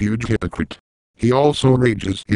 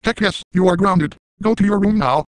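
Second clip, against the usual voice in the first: much higher, fast chipmunk-like robot voice; high sped-up Sam voice; 9 semitones higher than usual. Pretend. Squeaky high voice mocking a grounded threat maker. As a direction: play a squeaky sped-up text voice yelling threats. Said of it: "using Sam's voice in a high pitch".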